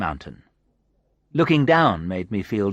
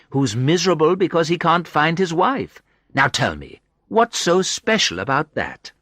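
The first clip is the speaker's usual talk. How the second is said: higher, playful woman's voice; lightly teasing woman; 6 semitones higher than usual. A narrator's reading. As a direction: needle him gently as her. Sandra teasing.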